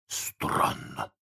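soft puzzled whisper. A narrator's reading. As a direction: whisper it, baffled. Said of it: "прошептал Неряха".

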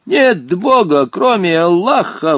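slow, high-pitched solemn cry; exclaiming old genie. A narrator's reading. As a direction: proclaim it with drawn-out fervour. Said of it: "воскликнул незнакомец". (торжественное восклицание, протяжно)

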